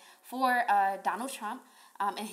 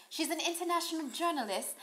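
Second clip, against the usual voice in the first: put-on British accent picked back up, higher; mock British accent; 6 semitones higher than usual. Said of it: "I lost the accent".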